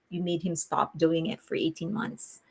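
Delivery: ordinary delivery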